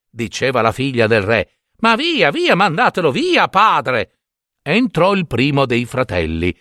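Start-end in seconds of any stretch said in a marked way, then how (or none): 1.8-4.2 high-pitched, impatient princess sending someone away; imperious dismissal. Acted. King's daughter dismisses a suitor to her father, imperious. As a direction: wave him away in a raised pitch.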